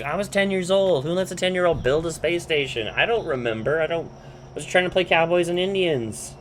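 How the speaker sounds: defensive protest. Retold or imitated. Rusty excusing the space station he built, defensive.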